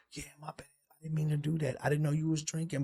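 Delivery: very soft, half-whispered apology; sheepish mock apology. Joker apologizing to the one who was drinking, sheepish mock apology.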